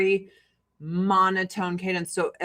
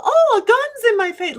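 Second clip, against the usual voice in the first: mock alarm, much higher and louder; sarcastic shriek; 7 dB louder than usual. Mocking a friend after a gun pulled, sarcastic high shriek. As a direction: fake panic, sarcastic. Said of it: "Well, I was in the right".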